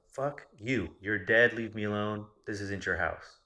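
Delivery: retold defiant telling-off. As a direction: re-enact telling an intruder off. Telling off an unseen presence.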